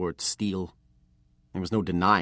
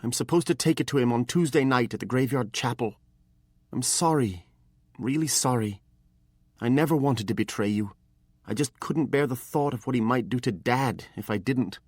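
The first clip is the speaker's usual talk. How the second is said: put-on higher, earnest young apprentice; pleading boy; 6 semitones higher, 3 dB louder than usual. A narrator's reading. Apprentice explains the betrayal to his master, higher and pleading. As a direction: beg to be understood.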